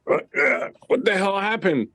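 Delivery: very high, bewildered voice; hurt drunk asking what happened; dazed drunk. Acted. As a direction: squeak out the confusion.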